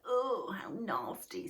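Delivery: slow, drawn-out disgust. A narrator's reading. Moomin Troll picking through melting snow, slow and disgusted. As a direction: wrinkle your nose at the wet snow. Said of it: "picking his way gingerly".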